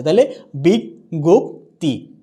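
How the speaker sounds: বিজ্ঞপ্তি drawn out as "বিগ্গোপ্তি"; slow model word. Shown as a demonstration. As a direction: stretch out বিজ্ঞপ্তি slowly as a model. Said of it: "slow model word".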